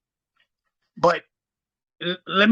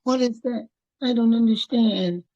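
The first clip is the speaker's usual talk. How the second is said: high, whiny mock confusion; mocking confused viewers; 6 semitones higher than usual. Mocking viewers who won't read the trust, in a high whine. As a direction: make fun of their puzzled whine. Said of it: "a lot of you guys are just gonna go".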